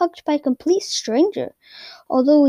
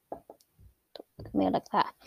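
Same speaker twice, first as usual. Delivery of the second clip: quiet aside; 4 semitones lower, 10 dB quieter, slower than usual. Real, not acted. Aside aimed at a listener.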